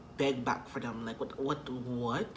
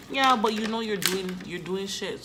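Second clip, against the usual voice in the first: higher and louder, heated retort; retold shout back; 6 semitones higher, 6 dB louder than usual. Snapping back at boyfriend, loud and high. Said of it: "I'm like".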